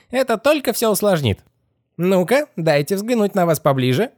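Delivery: high, creepy squeaky warning; squealing skeleton head. Acted. Skeleton stopping the girl's escape, high squeal. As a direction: warn them in a squeaky creature voice.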